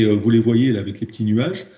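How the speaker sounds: ordinary delivery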